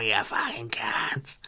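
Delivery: slow, whispered mock [name] insult; [name]'s talking voice. Mocking [name] whispering the end of her greeting to the crowd. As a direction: drop to a whispered, crude sign-off. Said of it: "And her talking voice, horrendous".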